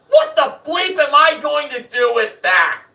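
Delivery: high, loud exasperated demand, acted out; indignant outburst. Angry contestant to the host about a billiards table, indignant outburst. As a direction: throw the prize back in his face.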